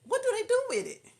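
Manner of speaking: high, loud squeal of bafflement; baffled high-pitched shout; incredulous. Real, not acted. Incredulous reaction to the story.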